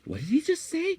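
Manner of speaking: high, loud baffled squeal; baffled question. Retold or imitated. Staffer retelling confusion at his boss, high baffled squeal. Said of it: "we would just".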